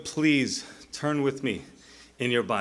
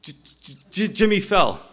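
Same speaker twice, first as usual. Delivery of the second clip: faint stuck J, then a high burst; mock stutter, released suddenly louder; imitated stutter; 5 semitones higher, 6 dB louder than usual. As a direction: stammer into the punchline. Acting stutterer's punchline.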